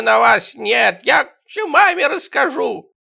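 high, slow put-on kid voice; a tattling kid threatening mom; whiny tattletale child.

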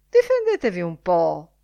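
loud challenge to the room; a loud, teasing challenge; challenging call. A narrator's reading. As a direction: throw the dare out loudly. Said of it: "disse mister O'Maddenberg".

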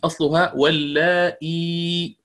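واللائي said slowly, the long la drawn out; original form demo. Shown as a demonstration. Teacher showing students the word's original form slowly.

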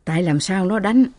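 ordinary delivery

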